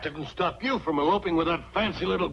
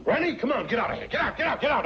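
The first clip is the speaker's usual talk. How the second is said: shooing; 9 semitones higher, much faster than usual. Acted. Grandpa chasing someone out.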